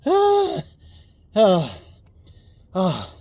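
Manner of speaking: cold gasps. Real, not acted. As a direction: gasp through the cold, then settle.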